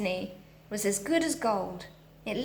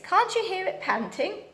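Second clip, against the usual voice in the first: Moonface; 9 semitones higher than usual. A narrator's reading. Moonface voiced in story.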